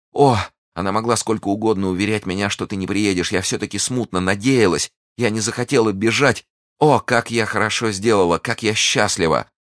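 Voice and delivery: lifted, joyful and emotional; happy relief. Acted. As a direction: pour out your relief to your love. Heroine happy at the reunion.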